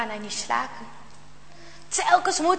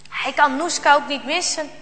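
ordinary delivery

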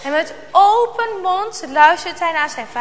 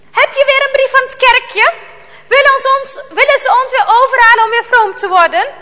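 much higher, louder, angry accusation; angry father; 8 semitones higher, 8 dB louder than usual. A narrator's reading. Father confronts mother over the letter, angry and loud. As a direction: rail at her about the church.

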